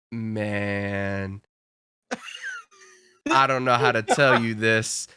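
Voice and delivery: low, drawn-out 'man' then hesitant; reluctant bad news. Cashier breaking bad news to coworker, low reluctant voice. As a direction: drop low as if dreading to tell.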